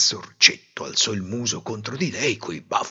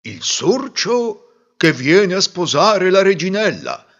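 slow, louder, ceremonious butler's call; formal announcement; 7 semitones higher, 5 dB louder than usual. A narrator's reading. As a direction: proclaim it to the whole court. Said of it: "annunciò il maggiordomo". (annuncio solenne del maggiordomo, lento)

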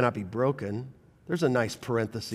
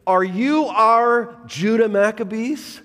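louder, higher, slow wondering question; hopeful crowd; 8 semitones higher, 6 dB louder, slower than usual. Retold or imitated. People wondering if Jesus is their Maccabee, hopeful loud question. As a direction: ask like they hope for a deliverer. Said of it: "here's what they're thinking".

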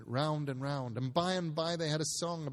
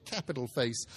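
usual speaking voice

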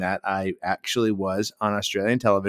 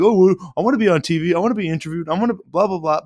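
mock whiny fan voice, higher and fast; mocking eager fans; 6 semitones higher, faster than usual. Imitating fans lining up to be interviewed, mock whiny eagerness. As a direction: ham up the over-eager fan begging for TV.

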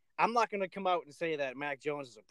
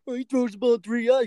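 mock whiny critic voice, higher and louder; 7 semitones higher, 6 dB louder than usual. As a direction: sneer it like a know-it-all fan. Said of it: "everyone wants to say".

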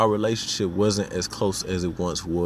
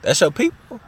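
much higher, rising mock disbelief; surprised question; 12 semitones higher, 3 dB louder than usual. Asking a new acquaintance about his family, high and surprised. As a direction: ask it like you can't believe it. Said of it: "and you like".

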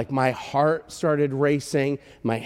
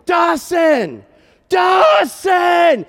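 high-pitched shout, building louder with each call; yelling his name; 9 dB louder, much slower than usual. Retold or imitated. Father calling for his lost son, yelling louder each time. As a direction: yell it out, stretching the last call. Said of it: "I just started yelling his name".

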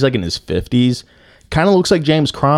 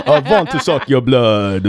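mock Dracula Transylvanian accent; jokey Transylvanian vampire accent; 2 semitones higher than usual. Shown as a demonstration. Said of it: "not go Transylvanian".

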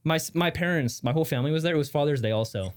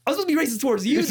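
high-pitched, laughing retort; 12 semitones higher, 5 dB louder, much faster than usual. Retold or imitated. Telling friend her comeback to the man, laughing. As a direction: retell the comeback through laughter.

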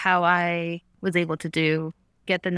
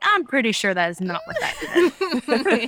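mock puzzlement; 6 semitones higher, slower than usual. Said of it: "I'd try it and be like".

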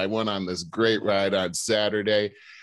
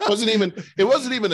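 laughing; 13 semitones higher, 5 dB louder, faster than usual. Real, not acted. Laughing after squeak imitation.

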